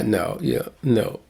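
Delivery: ordinary talk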